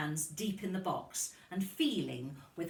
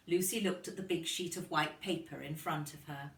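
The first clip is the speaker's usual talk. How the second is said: ordinary delivery, nothing marked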